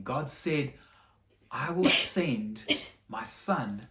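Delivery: ordinary delivery, nothing marked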